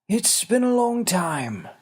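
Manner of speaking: a small ancient man greeting a friend; little winged man. Acted. Wizard greets old creature.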